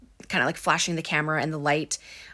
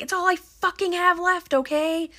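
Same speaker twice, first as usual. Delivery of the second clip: high-pitched acted distress; pitched-up mock-tearful outburst; imitated crying; 13 semitones higher, 4 dB louder than usual. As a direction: act out an upset, tearful protest. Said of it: "Heather is, like, crying".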